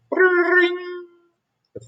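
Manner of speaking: very high and drawn out very slowly like a ringtone; ringing phone. Acted.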